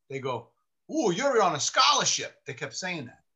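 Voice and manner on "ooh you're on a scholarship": high, louder mock-impressed ooh; mocking teasing. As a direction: imitate colleagues ribbing the newcomer. Colleagues tease the newcomer.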